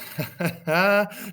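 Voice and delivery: loud, high ha-ha laugh; hammed-up villain's gloating laugh; triumphant laugh. Hector laughs as the Greeks flee, loud gloating laugh. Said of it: "Hector again was laughing".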